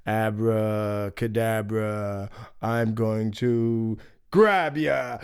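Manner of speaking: slow menacing wolf. Acted. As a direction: stretch it out, then pounce.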